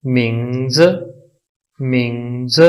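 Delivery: मिंग्स drawn out, first part lifted, last part dropped; tone demo. Shown as a demonstration. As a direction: model मिंग्स slowly for learners. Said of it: "तो इसकी pronunciation होगी".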